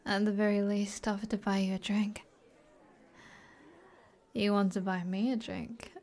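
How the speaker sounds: ordinary talk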